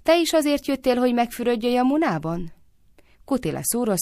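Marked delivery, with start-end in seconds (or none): 0.0-2.5 high, sing-song innocent question. A narrator's reading. Lalita asking Kutila about bathing, innocently. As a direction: ask sweetly innocent, pitched well up. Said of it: "kérdezte Lalita ártatlanul".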